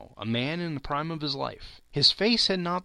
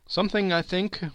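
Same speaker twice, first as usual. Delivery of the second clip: insisting; 7 semitones higher than usual. A narrator's reading. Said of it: "the ghost insisted".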